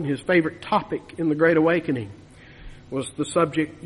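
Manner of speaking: normal talking voice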